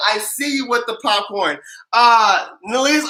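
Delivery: ordinary talk, nothing marked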